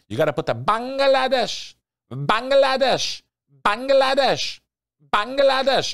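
Bangladesh in a high, loud, sing-song lilt falling at the end; savoring the name. Shown as a demonstration. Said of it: "I love saying that word".

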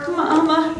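high, loud call back; strained calling answer. A narrator's reading. Stranded boy answers his mother's call, high urgent shout. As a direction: shout back with all your strength. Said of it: "mustered all his strength to answer".

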